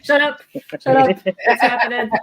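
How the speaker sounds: laughing, loud shut up; excited disbelief. Real, not acted. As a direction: squeal it out in delight.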